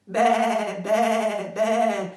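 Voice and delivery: high, loud bleating "Be, be, be" like a lamb; sheep bleat. Shown as a demonstration. Speaker showing the audience their chorus line, bleating. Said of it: "tenéis que decir".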